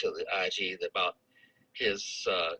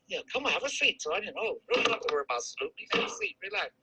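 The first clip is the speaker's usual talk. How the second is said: higher, overly sweet invitation to sit; mock friendly welcome; 12 semitones higher than usual. Captain greeting the sergeant with a mock friendly welcome. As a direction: imitate a two-faced officer's fake charm. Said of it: "'Cause he'll smile in your face".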